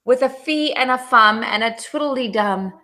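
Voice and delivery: usual speaking voice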